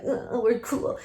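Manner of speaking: mock too-cool teenager, softly; affected cool-kid voice; too-cool clique. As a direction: play the smug popular kid. Mocking the popular high-school clique.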